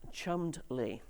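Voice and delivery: "Chummed Lee" said slowly in two parts, CHUMMED then LEE; surprising pronunciation. Shown as a demonstration. Speaker reveals to the audience how the name is pronounced, slow careful pronunciation. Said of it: "The second word is pronounced".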